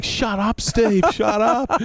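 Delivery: high, slow, fed-up yell; mock exasperated shout. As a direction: tell your chattering teammate to shut it. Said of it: "Wadey was just going".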